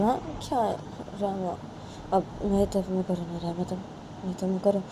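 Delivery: quiet sweet pleading; pleading softly. Acted. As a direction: gently coax the upset friend. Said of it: "तो बानी बोलती है".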